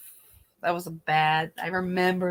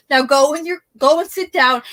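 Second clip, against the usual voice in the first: bossy Barbara parody; 7 semitones higher, 8 dB louder than usual. Retold or imitated. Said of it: "where Barbara would tell the girl".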